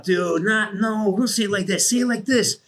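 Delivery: animated mock coaching from the sidelines; urgent coaching. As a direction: urge the new guy on, high and insistent. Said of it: "I'm like, I'm like, coach".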